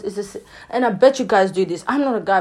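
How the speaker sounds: ordinary talk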